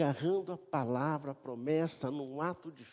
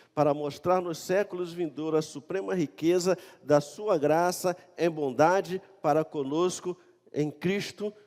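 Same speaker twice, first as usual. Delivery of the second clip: loud, declaimed Bible verse; preacher's raised reading voice; emphatic scripture reading; 4 semitones higher, 10 dB louder than usual. Acted. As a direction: proclaim the verse with force. Reads scripture aloud.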